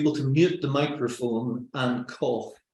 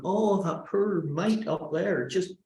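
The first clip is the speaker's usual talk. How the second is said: slow, soft, higher cooing pity; sympathetic pity; 4 semitones higher, 4 dB quieter than usual. Acted. Audience feeling for the speaker up there, softly pitying. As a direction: coo with sympathy, unhurried. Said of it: "they're feeling sympathy for you".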